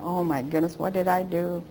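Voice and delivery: a hushed, worried gasp of a thought; quiet, anxious self-talk; scared. Retold or imitated. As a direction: whisper it to yourself as the fear grows. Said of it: "scareder and scareder".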